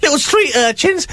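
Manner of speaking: high put-on urchin voice; street urchin. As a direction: pitch it up like a little urchin. Presenter introduces his urchin voice.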